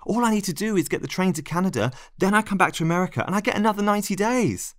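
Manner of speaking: high, urgent, rushing toward the end; desperate pleading. Acted. Narrator begs a lover not to walk away, pleading.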